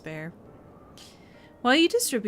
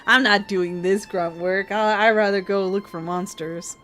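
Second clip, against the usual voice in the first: louder, slow, whiny mock complaint; mock Estinian; 7 dB louder than usual. Mocking Estinian dodging grunt work, slow whiny complaint. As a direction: drag out the sulky refusal. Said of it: "Estinian is like".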